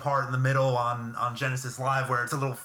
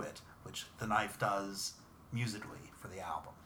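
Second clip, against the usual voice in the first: whispering; 5 semitones lower, 10 dB quieter than usual. Real, not acted. Drops to a whisper.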